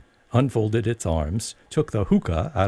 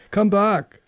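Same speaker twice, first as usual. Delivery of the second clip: higher, louder call across a distance; calling after her; 14 semitones higher, 5 dB louder than usual. A narrator's reading. Caterpillar calling after departing Alice, loud. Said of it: "the caterpillar called after her".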